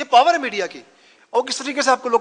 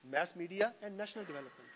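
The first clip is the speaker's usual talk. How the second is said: the title repeated slowly and much softer; 2 semitones lower, 19 dB quieter, much slower than usual. Shown as a demonstration. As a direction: echo the title under your breath for the class. (नाम धीमे और बहुत हल्के से दोहराते हुए)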